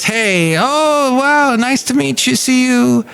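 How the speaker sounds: high-pitched, mock-delighted hello; gushing greeting. Father-in-law greeting a lady he met online, gushing. Acted greeting of a new acquaintance.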